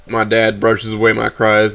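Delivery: ordinary delivery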